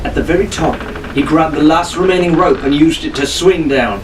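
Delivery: ordinary delivery, nothing marked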